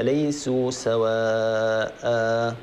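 slow recitation ending سواء with a held alif; Quran recitation. Shown as a demonstration. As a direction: recite slowly, holding the final alif. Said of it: "هكذا". (تلاوة بطيئة بمد ألف سواء)

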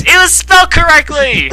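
high, loud outburst; excited exclamation. Real, not acted. Reader exclaiming over a correct spelling, high and loud. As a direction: exclaim it in amazement.

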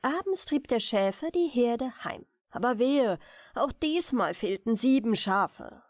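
usual speaking voice

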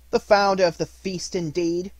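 high, loud scornful outburst; indignant cry. Acted. Mrs Cratchit mocks her husband's toast, loud and indignant. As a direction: spit it back at him. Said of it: "cried Missus Cratchit, reddening".

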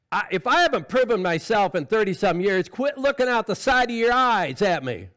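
loud, high, forceful preaching; full-force preaching. Acted. Preacher urging the church to grow up, full-force preaching. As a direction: drive it home to the congregation at full volume.

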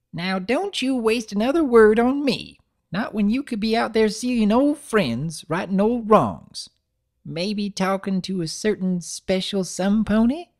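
put-on high, creaky-old grandmother voice; old granny voice.

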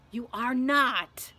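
slow, weighted, loud refusal; emphatic refusal. A narrator's reading. Ron refuses to let Hermione come along, emphatic. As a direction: forbid her, stretching out each word.

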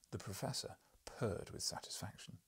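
ordinary delivery, nothing marked

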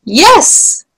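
high-pitched called-out answer; shouted reply. A narrator's reading. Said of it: "shouted Spittleworth".

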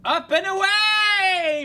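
high, loud, slow superhero cry; heroic shout. Pretend. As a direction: soar off like a caped hero. Launching off like a hero.

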